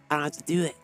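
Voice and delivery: ordinary talk, nothing marked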